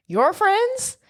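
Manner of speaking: very high, mock-appalled echo; imitating a taken-aback date; incredulous question. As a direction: shoot the question way up in disbelief. Acted dismay at his party friends.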